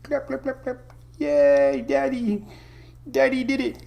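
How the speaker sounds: put-on high, squeaky baby voice for the dog; pet voice. Owner voicing his dog Hunter clapping for Daddy, high and squeaky. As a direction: talk for the puppy like it's cheering.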